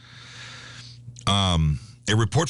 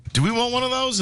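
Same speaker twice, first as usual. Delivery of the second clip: wife's question in a much higher pitch; imitating wife; 13 semitones higher than usual. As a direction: put on a high-pitched voice for the wife. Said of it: "She goes".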